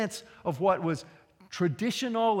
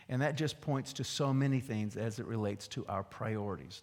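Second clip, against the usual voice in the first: ordinary talk, nothing marked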